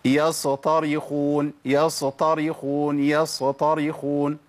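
"يصطرخون" with a short vowel slipped in after ص; wrongly vowelled Sad. Shown as a demonstration. Teacher showing a common mistake, added vowel on Sad. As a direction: say "يصطرخون" as "ya-so-ta-ri-khun". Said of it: "أنا وهي ساكنة أحركها".

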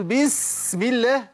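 high, slow "بسم" with an overlong hiss; mock an overblown reciter stretching the s of "بسم"; drawn-out chant on "بسم"; exaggerated recitation. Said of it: "ليه مبالغ يا أخي؟".

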